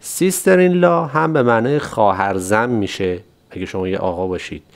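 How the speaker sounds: ordinary talk, nothing marked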